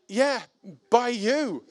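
high, pointed comeback; accusing retort. Retold or imitated. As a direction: throw the blame straight back at him. Accusing the thieving neighbour.

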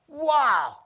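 high, loud burst of wonder; amazed outburst. Acted. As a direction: exclaim as if you just saw a miracle. Reliving a miracle, amazed.

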